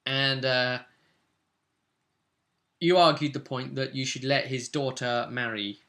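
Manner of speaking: ordinary delivery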